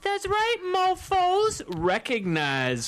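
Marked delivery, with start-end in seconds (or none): 0.0-1.6 squeaky boast, way up high; high squeal. Pretend. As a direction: squeal it like a hype man.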